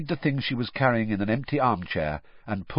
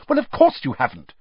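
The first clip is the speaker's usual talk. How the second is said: brisk; 4 semitones higher, 6 dB louder, faster than usual. A narrator's reading. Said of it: "said Hermione briskly".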